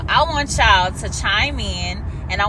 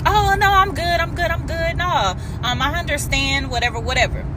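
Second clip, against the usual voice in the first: high, sing-song mock of a sulky man insisting he's fine; mocking the date; 7 semitones higher than usual. Imitating the rejected date to her audience, high and mocking. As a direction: send up his fake "I'm good" like a wounded ego.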